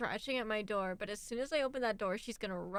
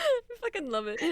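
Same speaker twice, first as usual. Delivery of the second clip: high, laughing and cracking up; can barely get it out for laughing; 8 semitones higher than usual. Real, not acted. Friends laughing hard.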